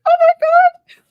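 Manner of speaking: very high, loud shocked squeal; mock horror squeal; shocked exclamation. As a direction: shriek it in horror. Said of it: "she's like".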